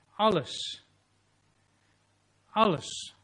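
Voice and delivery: rising emphasis on alles; emphatic repeat. Real, not acted. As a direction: build on the repeated word alles.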